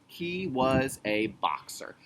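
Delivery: "He was a boxer" read slowly word by word; careful slow read-back. Shown as a demonstration. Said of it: "read it back".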